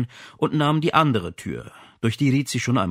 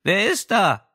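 high voice; 9 semitones higher, 4 dB louder, slower than usual. Acted. Unseen woman behind door.

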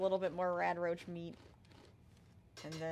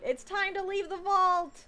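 dramatic announcement; 9 semitones higher, 5 dB louder, slower than usual. Pretend. Dramatic announcement leaving the vault.

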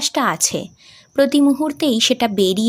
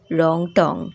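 father's voice; 5 semitones lower, much slower than usual. Acted. Father telling daughter the station name, lower. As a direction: answer simply like the father.

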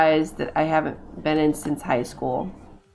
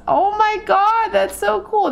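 high, loud squeal of delight; thrilled gamer squeal; excited squeal; 13 semitones higher, 4 dB louder than usual. Real, not acted. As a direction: squeal at the gator. First gator seen.

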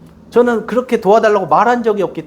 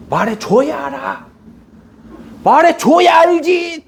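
building frustrated shout; 11 semitones higher, slower than usual. Retold or imitated. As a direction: escalate until you're shouting. Retold morning argument with wife.

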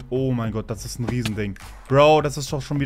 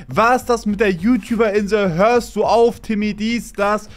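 mocking commenters; 7 semitones higher, 7 dB louder than usual. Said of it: "in die Kommentare geschrieben".

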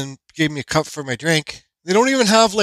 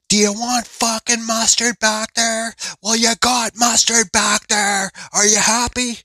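slow, higher mock rant; imagined yelling; 8 semitones higher, 3 dB louder, slower than usual. Imagined rant at the cashier over mustard, slow mock yell. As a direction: drag out an angry imagined rant. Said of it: "yelling out at the top of my lungs".

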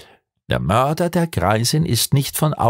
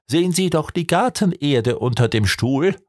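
ordinary delivery, nothing marked

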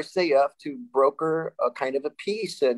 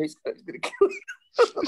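teasing warning. Retold or imitated. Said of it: "Favela had the best time to say".

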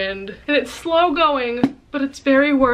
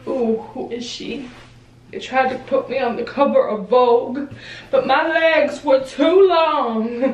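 strut it like a vain model; diva model voice; 2 semitones higher than usual. Pretend. Posing, model persona.

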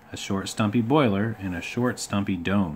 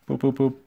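high, short train toots; imitated engine hoot; 5 semitones higher than usual. A narrator's reading. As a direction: toot like a waiting engine. Gordon's whistle imitated.

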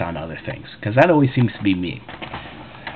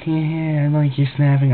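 higher, mock-sweet sarcasm; sarcastic; 6 semitones higher than usual. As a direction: play along sarcastically. Sarcastic reply to rude customer.

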